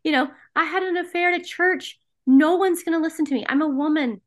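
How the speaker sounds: high-pitched, hurried objections; retold hysterical protest. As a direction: re-enact frantic pleading, pitched well up. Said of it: "because I was hysterical".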